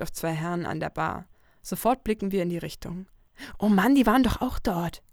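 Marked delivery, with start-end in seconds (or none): 3.6-5.0 annoyed. Acted. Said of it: "mit einem genervten Ton".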